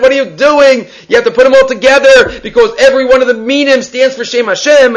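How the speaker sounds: retold scolding. Retold confrontation with guest in shul.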